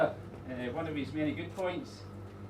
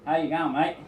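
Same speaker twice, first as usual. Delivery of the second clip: Aussie greeting; 2 semitones higher, 10 dB louder than usual. Retold or imitated. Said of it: "greeting that only an Aussie would come up with".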